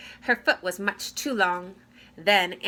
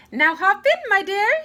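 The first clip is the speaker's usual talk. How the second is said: high, loud fairy voice; high cheerful fairy godmother; 12 semitones higher, 9 dB louder than usual. A narrator's reading. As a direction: shoo her in kindly, high. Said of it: "said the fairy godmother".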